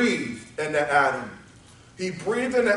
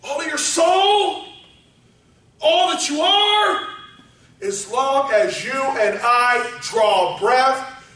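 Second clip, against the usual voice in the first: high then slow, drawn-out close; slow down, stretching praise the Lord; preaching that slows down; 5 semitones higher, slower than usual. Acted. Slowing sermon close.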